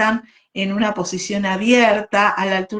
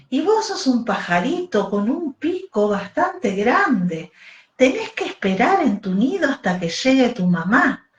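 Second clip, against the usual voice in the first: put-on bright, lively voice reading to little children; storytelling for kids; 3 semitones higher than usual. A narrator's reading. Teacher telling kids the baby bird story, animated storyteller. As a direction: tell it like a bedtime tale for a class.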